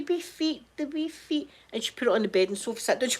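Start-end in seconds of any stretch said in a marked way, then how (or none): 0.0-1.5 high, soft cooing over tiny feet; doting mum. Retold or imitated. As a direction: adore the doll's feet like a gran. Mum admiring doll's feet.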